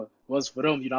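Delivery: ordinary talk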